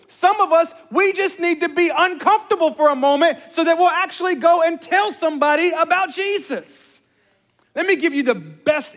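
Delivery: loud, high, driving sermon delivery; full-force preaching. Real, not acted. Preacher urging the church to tell somebody about Jesus, full-force preaching.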